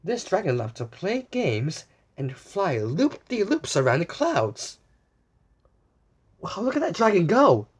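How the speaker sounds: usual speaking voice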